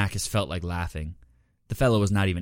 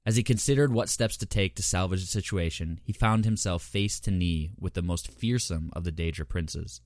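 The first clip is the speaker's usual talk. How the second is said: usual speaking voice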